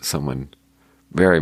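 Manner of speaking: ordinary talk, nothing marked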